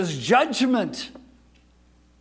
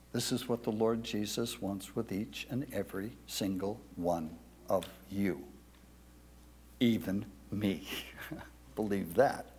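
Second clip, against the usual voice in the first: very soft and low; hushed appeal; 10 semitones lower, 12 dB quieter than usual. Real, not acted. Preacher tells each listener what Jesus wants, hushed. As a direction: confide it gently, well below preaching level.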